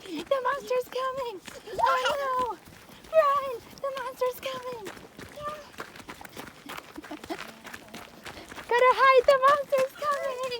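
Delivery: high, loud mock alarm; playful panic. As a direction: warn in pretend fright. Pretend fleeing monster.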